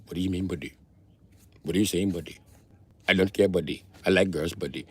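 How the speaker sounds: deadpan imitation of an Indian accent; mock Indian accent. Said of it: "you got a fucking Indian accent".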